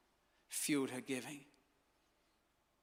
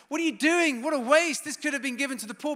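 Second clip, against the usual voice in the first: high, loud, appalled protest, fast at the end; horrified outburst; 8 semitones higher, 9 dB louder, faster than usual. Retold or imitated. Judas to Mary over the poured perfume, an outraged shout. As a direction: cry out at the waste in disgust. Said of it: "Judas Iscariot is horrified".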